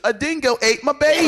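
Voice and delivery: laughing, hammed-up Australian accent that drifts toward London; mock Australian accent. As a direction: ham up an Australian accent through laughter, high and loud. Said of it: "That's the wrong accent".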